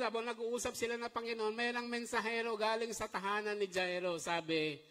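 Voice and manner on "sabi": ordinary talk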